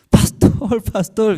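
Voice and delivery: high, loud happy calling out; an overjoyed brother calling his pastor; joyful call. Retold or imitated. (llamada alegre y emocionada)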